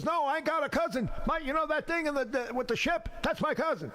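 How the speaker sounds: high, excited bragging; boasting cousin. Retold or imitated. Cousin bragging to his partners about Hank, high and excited. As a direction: show off your cousin to the partners.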